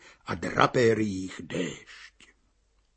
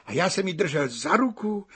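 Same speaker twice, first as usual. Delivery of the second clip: ordinary delivery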